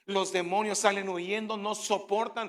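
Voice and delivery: loud, driving sermon cadence; preaching at full force. Acted. Preacher rallies the congregation about the spiritual world, loud. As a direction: rouse the congregation.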